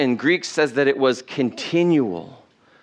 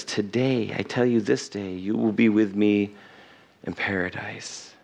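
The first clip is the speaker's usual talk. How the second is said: gentle promise; 6 semitones lower, 5 dB quieter than usual. Acted. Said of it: "And Jesus says".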